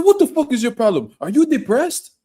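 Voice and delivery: high, loud African accent yelling in mock argument. Imitating an African boy cursing at a gas station, high loud accent. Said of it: "When Africans talk shit".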